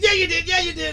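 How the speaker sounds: put-on high, loud, stubborn kid insisting; insistent kid. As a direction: insist like a kid who knows. Kid insists against dad.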